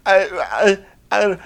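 high, loud, broken stammer; lost kid stammering. Retold or imitated. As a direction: stumble helplessly like a flustered recruit. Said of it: "the kid is just lost".

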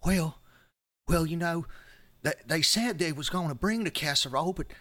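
high-pitched Southern American church minister; loud, high, hammed-up Southern fretting; Methodist music minister. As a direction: play a fussy Methodist minister with an American twang. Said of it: "Methodist music minister".

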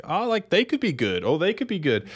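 high, airy mock optimism; mock hopeful. Podcast host mimicking fans' idle hype, mock hopeful. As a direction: sing it out like a wide-eyed fan.

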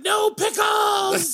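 very high, slow shrieking villain; Cobra Commander voice. Retold or imitated. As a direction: drag it out like a cartoon snake villain. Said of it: "getting that guy at a drive-thru".

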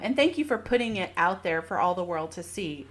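usual speaking voice

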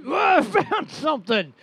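high-pitched put-on excitement; mock excited discovery. Mocking an Oak Island treasure find, put-on excitement.

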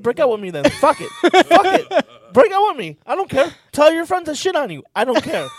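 high, loud, reckless rant; reckless giving up. Retold or imitated. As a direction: throw your hands up, nothing left to lose. Speaker voicing himself giving up.

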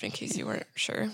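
breathy whisper to the listeners; whispered joke for the audience; whispered aside. Real, not acted.

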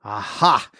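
low, mocking triumph; scornful cry. A narrator's reading. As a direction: gloat at the prince who climbed up. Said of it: "she cried scornfully".

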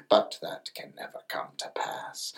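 hushed, unvoiced whisper. Grandmother warning the little mermaid, in a whisper. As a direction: breathe the warning, no voice.

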